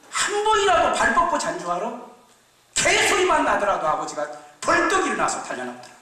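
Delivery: high, loud reproach; older brother reminding him how the father suffers, loud and high. Acted.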